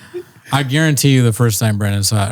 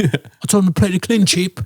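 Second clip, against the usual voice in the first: high impression; 7 semitones higher than usual. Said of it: "he's like".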